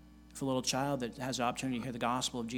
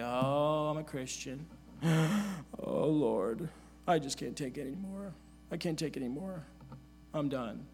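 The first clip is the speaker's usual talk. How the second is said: mock self-pity. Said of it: "pity parties for ourselves".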